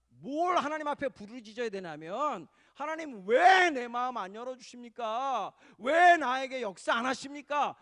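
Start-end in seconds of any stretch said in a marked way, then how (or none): 2.7-7.7 loud, high pleading shout, drawn-out questions; anguished, demanding prayer shouted upward; crying out to God. Shown as a demonstration. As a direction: cry out "why?" to God at full voice. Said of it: "이렇게 부르짖으셔야 돼요".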